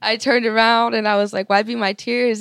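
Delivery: ordinary talk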